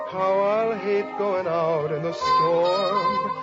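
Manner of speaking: usual speaking voice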